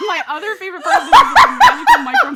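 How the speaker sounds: high, loud laughing shout. Real, not acted. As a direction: laugh out the words in disbelief.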